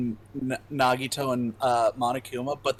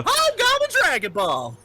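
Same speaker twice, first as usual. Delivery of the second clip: goofy shout; 6 dB louder than usual. Pretend.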